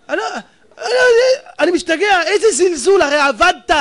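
preacher erupting at the waste; outraged outburst. Acted. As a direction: burst out in disbelief. Rabbi outraged at spending.

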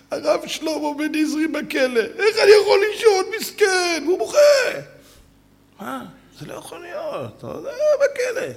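high, crying, broken lament; tearful high lament; weeping rabbi. Retold or imitated. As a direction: weep for the jailed friend. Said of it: "שומעים את הרב בוכה".

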